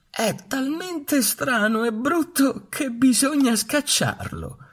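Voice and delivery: very high, slow sneering bully duck. A narrator's reading. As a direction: snap back as the swaggering duck. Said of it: "la spaccona ribatté". (anatra spaccona che ribatte, voce altissima e lenta)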